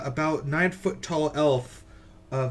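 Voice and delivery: ordinary delivery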